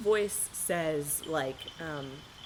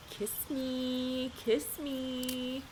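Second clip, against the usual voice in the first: higher, drawn-out plea; voicing "kiss me"; 5 semitones higher, 4 dB quieter, much slower than usual. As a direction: stretch each word in a raised sing-song. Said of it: "Does this one say "kiss me"?".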